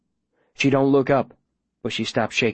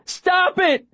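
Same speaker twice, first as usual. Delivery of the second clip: frantic shout. Acted. Boy pounding on the window to stop it, frantic shout. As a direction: yell it desperately through glass. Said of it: "I shout and pound".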